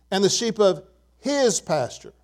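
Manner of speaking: his held out and set apart; stress on his. Shown as a demonstration. Preacher reading the psalm to congregation, his emphasized. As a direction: pause before his, then lean on it. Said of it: "I'm gonna emphasize the word his".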